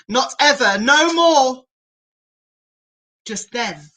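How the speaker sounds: usual speaking voice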